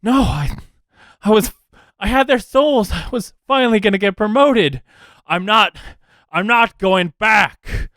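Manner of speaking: high, loudening protest, words breaking off and restarting; panicked outburst from a thwarted schemer; desperate Embra. Acted. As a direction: refuse frantically, pitched well up. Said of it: "Embra goes".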